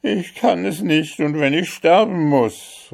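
high-pitched desperate protest; calling out. Acted. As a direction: cry it out as a captain under threat. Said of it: "rief der Hauptmann".

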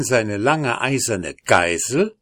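plain reading voice

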